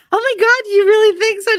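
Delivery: imitated high-pitched delighted gush; flattered squeal. Screaming woman suddenly won over by a compliment, flattered. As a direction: squeal it high as if thrilled by praise.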